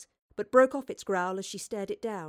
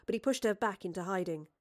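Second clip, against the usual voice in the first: ordinary delivery, nothing marked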